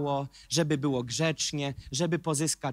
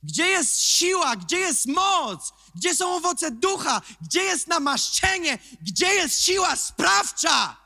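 high, loud, slow shouted questions; preaching at full force; 13 semitones higher, 6 dB louder, slower than usual. Acted. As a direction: thunder each question at the congregation. (kaznodzieja grzmi na zebranych, wysoko i głośno)

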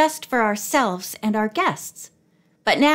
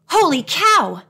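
put-on astonished boyish outburst in a read-aloud; explosive exclamation; 7 semitones higher, 4 dB louder than usual. A narrator's reading. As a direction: burst out with it, high and punchy. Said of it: "Jimmy exploded".

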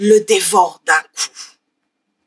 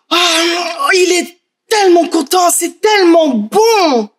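overjoyed; 6 semitones higher than usual. A narrator's reading. Narrator sharing Pietrino's joy.

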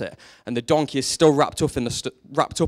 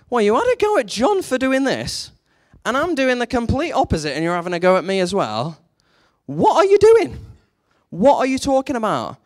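high, incredulous rebuke, building to a loud outburst; acted exasperation; 9 semitones higher, 6 dB louder than usual. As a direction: challenge the critics in mock disbelief. Jesus rebukes critics, acted out.

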